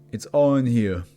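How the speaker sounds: entranced test subject; prisoner having revelation. Acted. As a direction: start intense, then slow down as it dawns.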